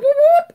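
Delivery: loud, high rising whoops; playful high-pitched whooping sound effect; imitated whoop. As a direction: whoop like something shooting upward. Imitates climber shooting up wall.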